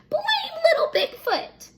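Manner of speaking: high, slow mocking shriek of the sisters; shrieked taunt. A narrator's reading. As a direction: shriek it out to mock him. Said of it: "they shrieked".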